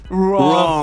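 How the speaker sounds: drawn-out mock verdict; Activision's buzzer verdict. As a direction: rule it out like a smug company. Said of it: "Activision would, would be like".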